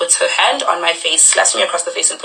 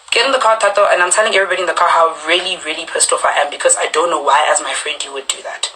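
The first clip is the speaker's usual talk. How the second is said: normal talking voice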